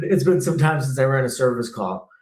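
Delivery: ordinary delivery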